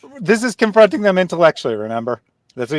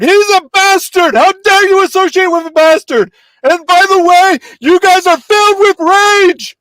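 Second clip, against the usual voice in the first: mocking yell; 11 semitones higher, 7 dB louder than usual. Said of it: "yelling it".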